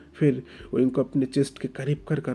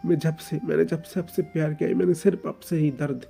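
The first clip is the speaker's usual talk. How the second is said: put-on higher, louder hurt young man, stammering; anguished outburst; 4 semitones higher, 5 dB louder than usual. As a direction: pour out the pain in a rising outburst. Oing's outburst about pain. (दर्द भरा फूटता हुआ ग़ुस्सा)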